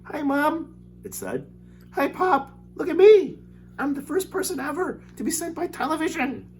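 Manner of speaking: very high mouse-like squeak; tiny squeaky voice. Acted. Shrunken boy greets his parents in a tiny, very high squeak. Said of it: "A tiny little voice ... squeaking of a mouse".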